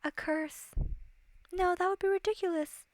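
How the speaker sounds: musing princess. Acted. Princess dismisses the idea of a curse, musing. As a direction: ponder it, then dismiss it daintily.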